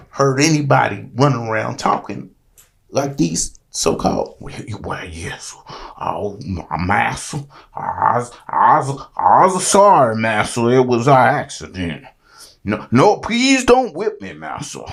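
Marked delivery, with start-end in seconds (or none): 4.4-7.4 whispered, slow, low mocking slave voice; hushed servile whisper of a mocked slave. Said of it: "ain't never heard nobody talk like that". 7.7-14.8 mildly hammed-up begging not to be whipped; mock pleading slave. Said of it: "ain't never heard nobody talk like that".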